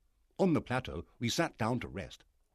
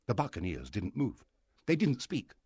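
usual speaking voice

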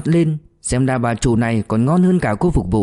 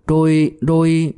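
slow, higher-pitched halting repeat; mildly acted scared stammer; faltering; 4 semitones higher, slower than usual. A narrator's reading. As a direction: trail off haltingly, unable to finish. Frightened girl falters mid-report.

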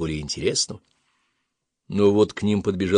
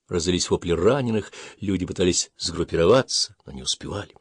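ordinary delivery, nothing marked

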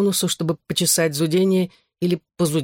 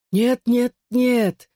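higher, insistent refusal; stubborn protest; 7 semitones higher, much slower than usual. A narrator's reading. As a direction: object stubbornly, pitch raised. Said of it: "упрямо возразил я". (упрямо возражая)

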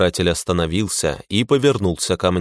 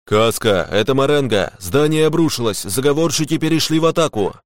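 high, urgent voice rising as it goes; alarmed radio report; 6 semitones higher than usual. Acted. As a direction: report an attack over comms. Alarmed battle report over radio. (тревожный доклад по связи, высоким голосом)

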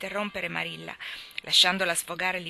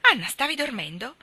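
higher and louder, a sharp startled question; startled call; 7 semitones higher, 7 dB louder than usual. Acted. Marilla calls to the girl hidden in the pillow, startled. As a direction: call out to her, alarmed.

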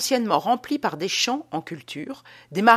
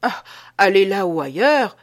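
a louder, light joking brush-off; 7 dB louder than usual. Acted. Petitot jokes to dodge Théophraste's suspicion, louder. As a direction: toss it off as a jest. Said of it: "dit monsieur Petitot en plaisantant".

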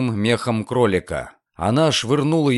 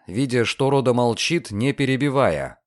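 plain reading voice

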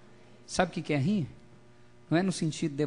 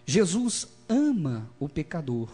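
normal talking voice

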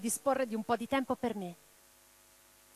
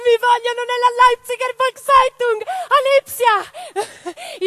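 much higher and louder, bursting with excitement; excited announcement; 13 semitones higher, 14 dB louder, slower than usual. Acted. Woman thrilled to tell her partner about the job. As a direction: blurt it out thrilled, drawing out a Lipsia slowly.

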